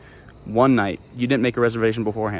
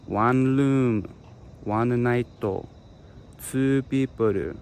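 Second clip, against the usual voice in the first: slow, clipped Japanese-accented words; much slower than usual. Shown as a demonstration. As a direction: say each word in katakana style, slowly. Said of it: "and you say".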